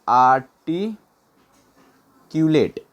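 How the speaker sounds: आर्टिक्यूलेट very slow, a long gap before culotte; broken up slowly. Shown as a demonstration. Teacher having learners write it down, आर्टिक्यूलेट in slow pieces. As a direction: stretch आर्टिक्यूलेट out in separate pieces. Teacher breaks word for note-taking.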